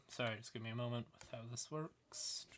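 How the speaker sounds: a quick whispered apology while fixing things; whispered aside. Real, not acted. Presenter fixing his screen share mid-talk, whispered aside. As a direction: murmur it under your breath.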